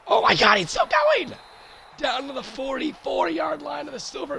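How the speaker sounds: strained, cracking excited shout. Real, not acted. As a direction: voice cracking on a high yell. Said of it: "I sound like an old man".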